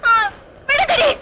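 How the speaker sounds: shrill help-me panic; frightened cries. Acted. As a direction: scream to be let go. Being grabbed, crying for help.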